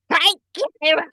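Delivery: imitated accent, pitched high; a quick high catchphrase in a mimicked accent; Billy Kay's accent. Host mimicking a wrestler's greeting, high imitated accent. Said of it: "And her fucking accent".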